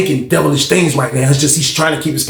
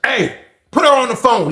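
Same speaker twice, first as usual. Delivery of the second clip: angry demand; 9 semitones higher than usual. Retold or imitated. Banks on phone, loud.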